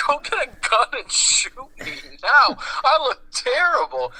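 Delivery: high-pitched mock anguish; imitated despairing grandpa. Grandpa horrified by his own looks, imitated despair. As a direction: wail it in a hammed-up high voice.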